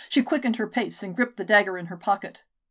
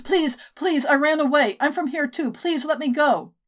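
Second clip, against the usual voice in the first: higher, pleading for her life; desperate begging; 7 semitones higher, 5 dB louder than usual. Acted. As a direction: beg them to let her go, slowing at the end. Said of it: "begged in the local dialect".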